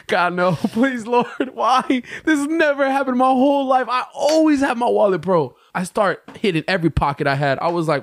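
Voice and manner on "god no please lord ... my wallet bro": high, pleading wail; hammed-up desperate begging, pitched way up; panicked pleading. Traveler begging the Lord over lost wallet, high panicked plea. Re-enacting finding his wallet missing.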